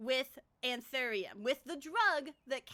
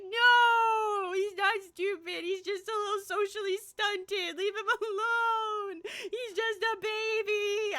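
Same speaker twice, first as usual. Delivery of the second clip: higher, louder, slow whiny defense of him; protesting retort; 9 semitones higher, 6 dB louder, slower than usual. Retold or imitated. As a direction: plead like a parent shielding a child. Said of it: "I was like".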